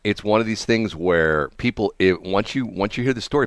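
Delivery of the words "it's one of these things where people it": ordinary talk, nothing marked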